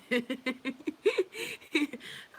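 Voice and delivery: high, loud laughter; long real burst of laughter; cracking up. Real, not acted. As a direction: laugh hard at your own story. Laughing at own story.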